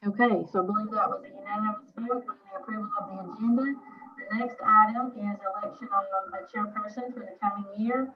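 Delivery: muffled, like a hand over the mouth. Real, not acted. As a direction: speak through a covered mouth. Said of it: "your voice is muffled".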